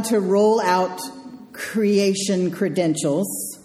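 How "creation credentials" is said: ordinary talk, nothing marked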